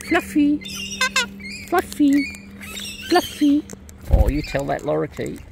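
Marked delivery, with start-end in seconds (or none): 0.1-3.6 loud, high bird calls. Real, not acted. Owner calling her birds to come, loud and high. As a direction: sing out the names, high and loud.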